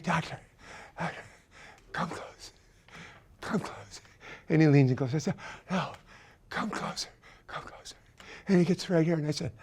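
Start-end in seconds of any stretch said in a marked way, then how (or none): 0.0-7.9 unvoiced whisper, beckoning; conspiratorial whisper calling the doctor in. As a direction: whisper him closer.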